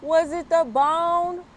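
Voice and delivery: slow, high, loud holler; dog hollering after the bird. Acted. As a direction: holler across the yard, dragging it out. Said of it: "Rocket hollered".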